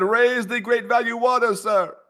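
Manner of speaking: high, slow mock command; hammed-up leader ordering wells. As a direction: bark it like a pompous leader. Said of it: "I said".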